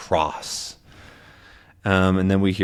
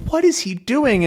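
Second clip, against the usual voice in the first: high, bewildered question; puzzled captain watching the dance; mild mock bewilderment; baffled; 13 semitones higher than usual. Haddock confused.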